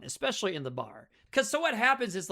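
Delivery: ordinary talk, nothing marked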